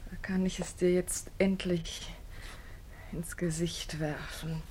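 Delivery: low, hissing whisper; spiteful whisper. Acted. Pauline whispering spitefully that she will throw the money. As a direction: threaten him under your breath.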